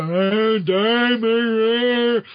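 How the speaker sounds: slow, stretched-out mock zombie groan. Carrion as a zombie accusing Peter, slurred zombie voice. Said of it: "if he sees he's a zombie".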